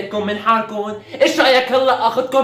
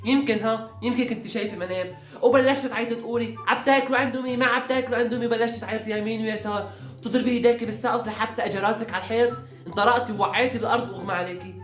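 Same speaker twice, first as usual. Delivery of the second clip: 1 hushed storytelling; 3 semitones lower, 8 dB quieter than usual. Acted. Mother quietly retelling a fake story.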